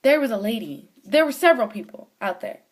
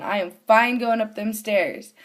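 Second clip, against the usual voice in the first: usual speaking voice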